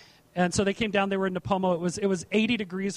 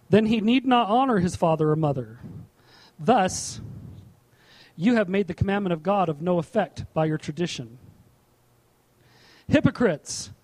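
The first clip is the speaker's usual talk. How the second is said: louder, measured Bible reading; scripture reading; 2 semitones lower, 3 dB louder than usual. Acted. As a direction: read the verse out to the congregation with weight. Reads scripture aloud.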